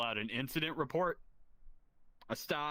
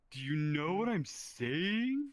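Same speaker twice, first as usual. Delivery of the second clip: slow drawn-out coworker voice; slow dragging catchphrase; coworker impression; 2 semitones higher, slower than usual. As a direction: stretch it out, lean on the question. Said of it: "he talks like this".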